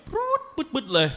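high trilled zoom sound, then quick bursts; imitated rapid reading. Student telling his teacher how fast reading would go, high zooming noise. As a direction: mimic racing through a recitation. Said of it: "อ่านเร็วๆ".

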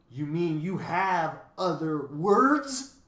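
loud, slow delighted question climbing high; stretched-out, gleeful cry; happy cry. A narrator's reading. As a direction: pounce on it with rising delight. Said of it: "cried the bird happily".

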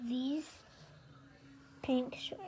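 whispering. Real, not acted. Whispered line.

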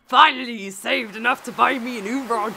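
lightly voiced old sailor; a hint of pirate. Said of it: "pirate voice for Mister Willy now".

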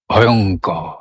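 low, drawn-out word full of feeling; expressive dread. Shown as a demonstration. Teacher shows how the word carries its feeling, expressive dread. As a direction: let ভয়ঙ্কর carry the dread, low. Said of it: "দেখি তো আরেকবার করে".

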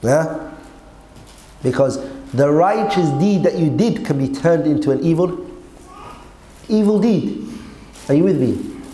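ordinary talk, nothing marked

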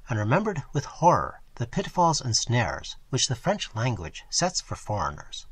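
plain reading voice

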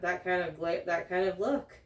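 normal talking voice

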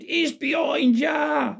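high, drawn-out mock shout; panto audience shout. Panto audience warning Buttons of the ghost, high mock shouting. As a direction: imitate kids calling out, very high and slow. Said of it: "again and again".

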